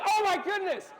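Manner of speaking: high, loud shocked exclamation; astonished shout. Retold or imitated. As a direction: shout it in amazed disbelief. Acted reaction to friend's body slam.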